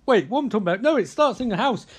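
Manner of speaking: ordinary delivery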